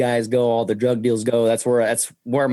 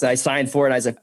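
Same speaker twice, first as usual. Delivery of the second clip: ordinary talk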